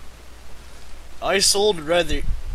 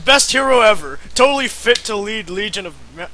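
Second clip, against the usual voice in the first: loud, high mock praise; sarcastic cheer; 7 semitones higher, 8 dB louder, faster than usual. Real, not acted. Mocks the hero's leadership with sarcastic cheering. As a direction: oversell it sarcastically.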